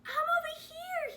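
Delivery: high, loud answering shout; stranded frog answering the rescuer; crying out. Acted. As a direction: yell back through the rain. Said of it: "he cried".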